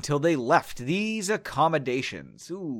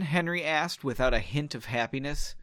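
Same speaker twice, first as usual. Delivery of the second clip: plain reading voice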